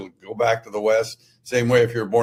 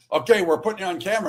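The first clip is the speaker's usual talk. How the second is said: rushed producer; 9 semitones higher, much faster than usual. Retold or imitated. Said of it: "And so they said".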